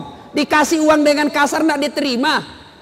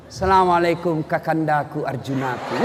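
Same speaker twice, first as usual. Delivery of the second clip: sweet wife; 9 semitones lower, 5 dB quieter than usual. Retold or imitated. Loving wife greets husband.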